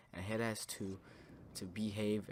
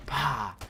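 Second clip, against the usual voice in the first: loud, higher vocal punch sound; imitated smack; 4 semitones higher, 9 dB louder than usual. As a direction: pop it out like a hit landing. Imitates a blow to head.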